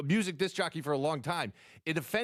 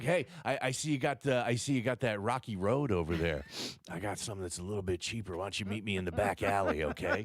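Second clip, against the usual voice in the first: low, hushed back-alley hustler; shady dealer voice; 9 semitones lower than usual. Pretend. Shady ice cream dealer voiced.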